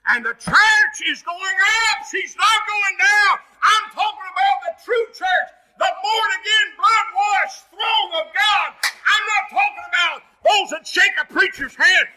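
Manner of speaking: shouted, high-pitched revival preaching; full-force preaching. Acted.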